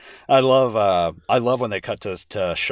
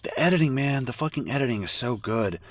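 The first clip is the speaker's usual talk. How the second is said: normal talking voice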